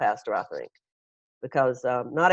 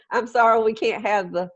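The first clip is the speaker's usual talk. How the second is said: ordinary delivery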